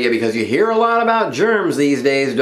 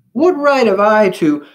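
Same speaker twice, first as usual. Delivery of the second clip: high, loud protest, cut off; pitched-up self-questioning; little germ's troubled thought; 11 semitones higher, 6 dB louder than usual. A narrator's reading. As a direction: wail it in a small character voice, then break off. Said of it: "he thought".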